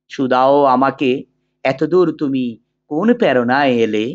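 measured, sustained poetry recital with drawn-out phrase endings; poem recitation. Acted. Teacher reciting the poem Amar Porichoy for students, in measured declamation. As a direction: declaim the verse slowly and evenly, pausing between couplets.

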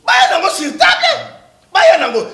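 high, loud insistent call; loud demand. Real, not acted. Demanding the documents, loud insistent shout. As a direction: bang it on the table with your voice.

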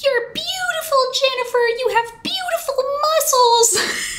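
very high-pitched imitation of a little girl; child cheering out. Five-year-old cheering competitors from the front row, high squealed imitation. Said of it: "she would cheer out".

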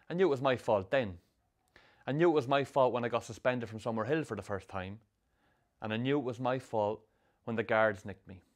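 ordinary delivery

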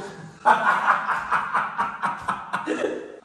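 high-pitched embarrassed giggle. Real, not acted. Laughing at compliment.

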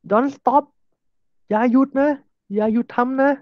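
high-pitched begging don't stop; mock desperate plea; high pleading. Shown as a demonstration. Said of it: "เป็น question tag ที่".

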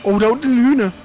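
ordinary talk

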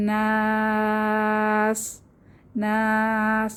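নাস chanted very slowly with the vowel held long; held recitation. Shown as a demonstration. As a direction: draw out নাস for three alif lengths. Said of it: "তিন আলিফ টেনে থামতে হবে".

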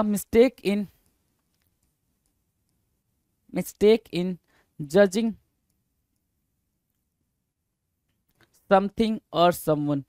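measured words, paced to the writing hand; slow dictation. Real, not acted. Teacher writing the definition for students, slow dictation. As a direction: piece by piece with long silences.

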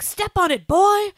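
put-on high, loud step on it boy; mock urgent dad to his son; hammed-up Sean Connery urging speed. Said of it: "Sean Connery is like".

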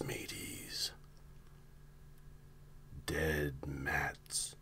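whispered salty pirate drawl; hushed old sea dog, conspiratorial; gruff old fisherman. Acted. Pirate-talk whispered conspiracy intro.

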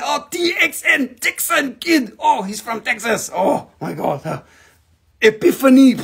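high, shouted imitation of the wife's eureka; wife's excited discovery. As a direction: play the wife cracking the riddle, thrilled. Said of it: "She said".

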